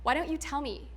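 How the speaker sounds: ordinary delivery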